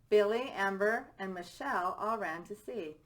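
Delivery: plain reading voice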